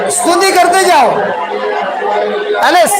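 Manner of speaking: high, full-voiced rallying cry; exhorting shout. Real, not acted. Preacher urges congregation to keep praising, exhorting shout.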